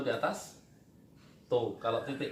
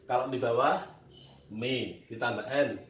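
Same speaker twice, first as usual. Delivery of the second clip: ordinary talk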